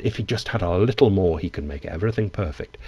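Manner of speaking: normal talking voice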